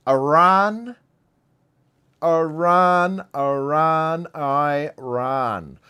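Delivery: loud, high "Iran" fading down each time; dramatic build-up, loud then dying away; calling out Iran. Real, not acted. Setting up "I ran from Iran.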